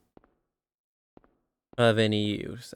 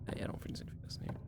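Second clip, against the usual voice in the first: quick whisper; 16 dB quieter, much faster than usual. Real, not acted. Fast whispered aside.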